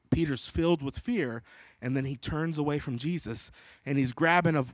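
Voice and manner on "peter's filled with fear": ordinary delivery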